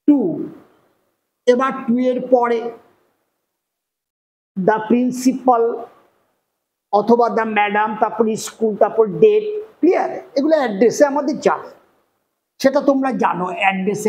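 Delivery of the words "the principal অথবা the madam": dictating. Shown as a demonstration. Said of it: "dictating the letter's address".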